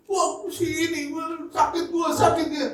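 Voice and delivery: high, drawn-out mock whining; mock hurt complaining. Hurt churchgoer complaining about someone, mock whining. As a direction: play up the wounded complaint, high-pitched.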